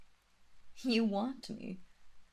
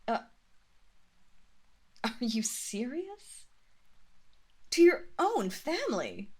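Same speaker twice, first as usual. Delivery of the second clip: higher, louder disbelief; shocked; 5 semitones higher, 4 dB louder than usual. Acted. Mistress shocked at her servant's suggestion. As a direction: react in shock.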